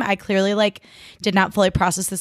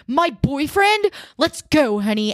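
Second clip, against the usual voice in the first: high, slow dramatic gasp of outrage; hammed-up jealous scene; mock outraged girlfriend; 7 semitones higher, slower than usual. Audrey throwing water on Max, mock outrage. Audrey's chaotic outburst voiced.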